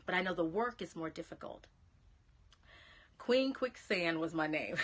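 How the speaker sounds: ordinary talk, nothing marked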